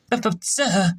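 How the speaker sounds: flustered, stumbling over the words; a nervous officer caught off guard, high and faltering; stammering. A narrator's reading. Shrub stammering to his captain, flustered and high. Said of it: "the faithful shrub stammered".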